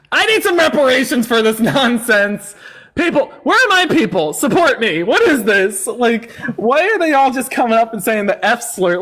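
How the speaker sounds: high, loud cry of protest; indignant outburst. Real, not acted. Streamer appealing to supporters about abuse, indignant. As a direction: protest loudly, pitch leaping up as you appeal for support.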